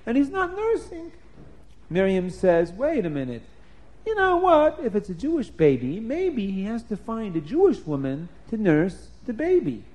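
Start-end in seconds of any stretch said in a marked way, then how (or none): 0.1-1.1 pitched way up in distress; wailing worry. Acted. Same words, now wailing. 2.7-9.8 put-on clever little girl. Little sister with clever idea.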